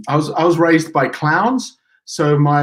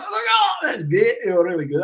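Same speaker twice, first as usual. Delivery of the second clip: mock Scottish praise, starting with a high shouted oh; a deliberately poor Scottish accent; a hammed-up, lightly done Scottish voice; bad Scottish accent; 6 semitones higher, 4 dB quieter than usual. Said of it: "I can do a bad Scottish accent".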